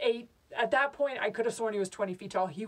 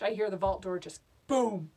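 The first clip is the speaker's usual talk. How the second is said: ordinary delivery, nothing marked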